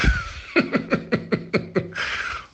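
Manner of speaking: sudden breathy laugh; high burst of laughter. Real, not acted. Laughing at his own advice to his younger self.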